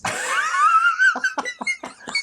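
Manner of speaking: loud burst of real laughter; big laugh. Real, not acted. Laughing at Mister T's apartment line, hearty laugh. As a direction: crack up. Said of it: "It's so funny".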